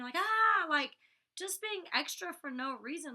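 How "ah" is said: high, loud mock shriek; mocking scream; imitated scream. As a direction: shriek like someone running around the house. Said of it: "running around the house screaming".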